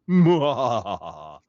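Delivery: loud evil muhaha cackle; villain laugh. Acted. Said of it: "I added the muhaha".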